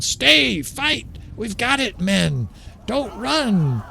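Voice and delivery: high, urgent battlefield rallying cry; commander yelling. Retold or imitated. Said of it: "Commanders would be yelling".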